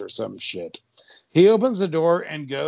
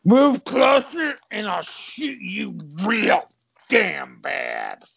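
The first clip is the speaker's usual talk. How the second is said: high, slow cop character voice; slow cartoon cop threat; threatening cop; 6 semitones higher, slower than usual. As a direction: draw out the threat, pitched high.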